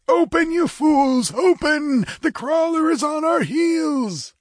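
high, drawn-out desperate scream. A narrator's reading. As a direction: scream in panic to be let in.

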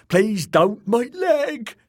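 high, loud begging in pain; pained pleading. A narrator's reading. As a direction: beg for his life. Wounded Dick begging.

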